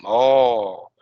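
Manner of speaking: an awed oh; amazed oh. Shown as a demonstration. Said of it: "something like this".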